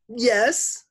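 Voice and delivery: put-on high, loud girlish answer; child Leslie's voice. A narrator's reading. Teacher answers the door in a stolen voice, high girlish. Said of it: "still in Leslie's voice".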